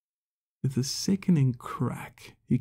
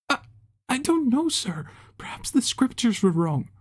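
much higher, stammering, whispery fear; terrified stammer; 12 semitones higher, 3 dB louder than usual. Acted. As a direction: stammer out an excuse in terror. Acolyte answers furious leader.

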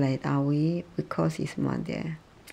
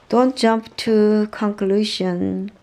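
emphatic drill; 5 semitones higher, 6 dB louder, slower than usual. Shown as a demonstration. Drilling phrase loudly.

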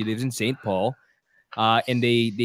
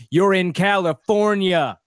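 mock country drawl, higher and drawn out; fake country accent; 6 semitones higher, 3 dB louder than usual. Californian mocking fake country people around home, drawled fake country accent. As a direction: imitate a fake cowboy's drawn-out country talk. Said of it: "talking like this".

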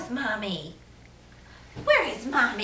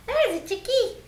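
surprised; 10 semitones higher than usual. Real, not acted. Peekaboo reveal to baby.

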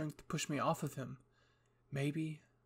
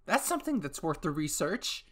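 high, excited and fast-talking; bubbly mad scientist; eager scientist voice; 6 semitones higher, 6 dB louder than usual. Acted. Hanjay character eager speech.

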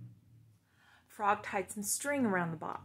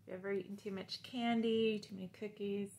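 put-on reading to children; 5 dB louder than usual. Teacher reads Frog and Toad aloud to the class.

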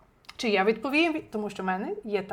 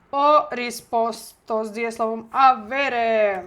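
slow dictation; 4 dB louder, slower than usual. Shown as a demonstration. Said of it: "повторюйте зараз вголос".

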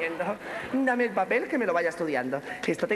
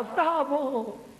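mock shout; 4 semitones higher than usual. The audience cheering her show, mock shouted bravo. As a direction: imitate a distant crowd's bravo. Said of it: "la gente gritaba".

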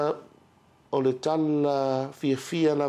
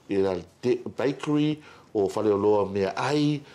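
usual speaking voice

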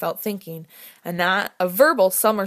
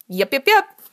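higher, louder chirpy dinosaur voice; Petrie imitation; 7 semitones higher, 7 dB louder than usual. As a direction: chirp it out like a cartoon pterodactyl. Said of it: "pitch-perfect imitation of Petrie".

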